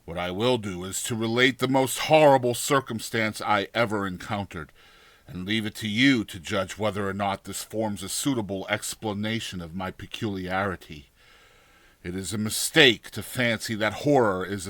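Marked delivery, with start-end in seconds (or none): none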